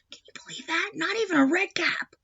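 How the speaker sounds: high, hushed disbelief; whispered aside. Real, not acted. Teacher whispering an aside to the child, amazed. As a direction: lean in and whisper it.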